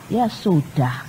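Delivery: soft, low, drawn-out giving in; amused resignation. Retold or imitated. Child giving in to mother's faith advice, amused resignation. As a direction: give in quietly, imitating the child. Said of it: "Dia ketawa, dia bilang".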